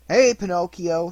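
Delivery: loud, slow call across the beach; shout. A narrator's reading. Biggest boy calling out to Pinocchio, loud and slow. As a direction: bellow his name, drawn out. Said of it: "shouted the biggest of the boys".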